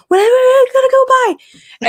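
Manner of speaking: very high, loud panicked brush-off; squeaky, loud flustered exit; flustered escape. Retold or imitated. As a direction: flee a crush in embarrassed panic. Embarrassed, fleeing from crush.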